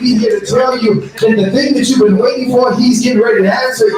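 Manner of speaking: high, shouting preacher build; preaching at full force. Acted. Preacher shouting to the congregation that the answer is coming. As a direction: whoop it up to the congregation.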